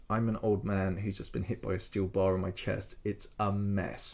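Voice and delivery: low, soft, spent voice; weary injured patient. Retold or imitated. Old Francis telling doctors about his chest, weak and low. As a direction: answer feebly, dragging the final words.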